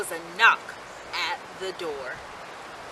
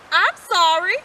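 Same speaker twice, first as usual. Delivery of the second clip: a higher, louder gentle mother; mother's voice; 6 semitones higher, 7 dB louder, slower than usual. A narrator's reading. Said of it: "said their mother one morning".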